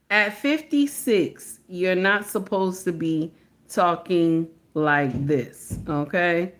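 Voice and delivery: very measured, spacing out each phrase; slow right down to drive the reprimand home; slow, deliberate admonishing. Real, not acted. Deliberate, emphatic scolding of Shannon.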